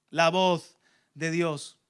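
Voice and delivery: ordinary talk, nothing marked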